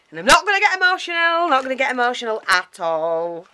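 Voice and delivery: high, loud sing-song denial, easing down; mock-emotional insistence. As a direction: wail it playfully, stretched high, then sink. Playfully denies getting emotional over gifts.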